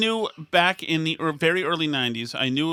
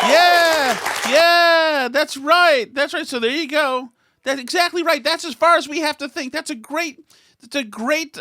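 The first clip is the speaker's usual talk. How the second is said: high, loud sarcastic cheering along; hammed-up approval, pitched way up; mock enthusiastic agreement; 11 semitones higher, 7 dB louder than usual. Host parrots an audience agreeing with the joke, mock enthusiastic.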